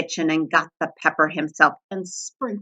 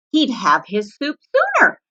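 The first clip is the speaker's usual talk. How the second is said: plain reading voice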